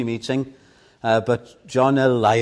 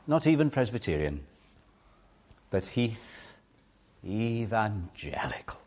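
tender invitation. Acted. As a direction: draw them in gently, almost whispering. Quiet preaching close.

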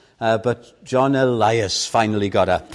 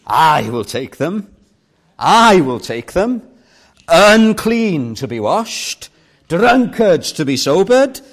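Jesus' voice; 6 semitones higher, 7 dB louder, slower than usual. Retold or imitated. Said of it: "I can hear Jesus saying".

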